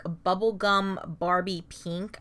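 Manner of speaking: ordinary talk, nothing marked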